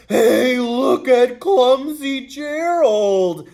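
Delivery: loud, high mocking jeer; sneering taunt. Lions sneering loudly at clumsy Gerald. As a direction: jeer at him like a playground bully. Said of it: "the animals all sneered".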